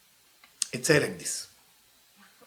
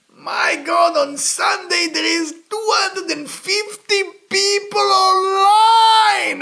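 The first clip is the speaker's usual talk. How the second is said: high, loud amazed exclaiming; excited outburst; 5 dB louder, much slower than usual. Real, not acted. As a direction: shout it out in disbelief. Excited retelling of crowded restaurant.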